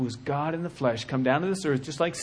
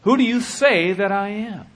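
higher and louder, pressing; louder repeat of the question; raised and urgent; 7 semitones higher, 7 dB louder than usual. Shown as a demonstration. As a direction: demand the answer. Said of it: "He's gonna ask you that question".

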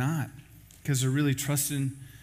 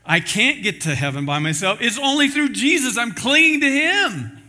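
higher, louder, slowing to a fervent cry; rising much higher and louder into an impassioned testimony; fervent declaration; 8 semitones higher, 7 dB louder than usual. Retold or imitated. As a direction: proclaim it like your life depends on it. Believer's declared answer.

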